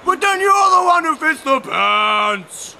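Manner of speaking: high, loud, slow excited shout. A narrator's reading. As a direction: shout the discovery triumphantly. Tom Thumb continues shouting.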